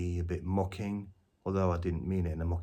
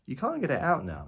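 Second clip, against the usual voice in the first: ordinary talk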